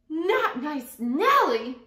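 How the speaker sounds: slow, high, loud wail of dread; fearful disbelief. Acted. Frightened townsfolk repeating the bandit's name, dread. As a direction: draw the name out in horror.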